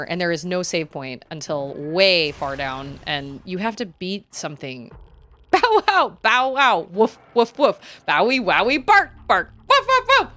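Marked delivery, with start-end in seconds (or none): 5.5-10.3 high, loud mock woofs; a cat clumsily playing dog; hammed-up barks and woofs; cat barking like a dog. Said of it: "Tiger has to learn how to be a dog".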